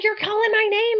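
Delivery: high, insistent; husband insisting. Retold or imitated. Husband telling his wife she called him, high and insistent. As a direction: push back, sure of it.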